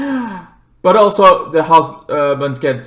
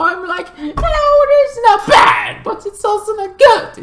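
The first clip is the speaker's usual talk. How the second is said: laughing squeak. Real, not acted. Laughing verdict on the movie.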